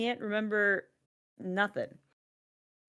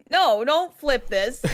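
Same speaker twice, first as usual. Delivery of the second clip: slow, higher, louder protest; mock indignant; 6 semitones higher, 4 dB louder, slower than usual. Dad protesting to daughter, slow and indignant. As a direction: refuse to let her turn it around. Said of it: "I'm like".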